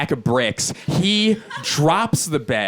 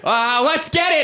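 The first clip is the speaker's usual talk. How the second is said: high-pitched, game battle cry; high mock-eager call to action; panicked rallying cry; 13 semitones higher than usual. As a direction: yelp it high, playing along in a panic. Panicked self joining roommate's screaming.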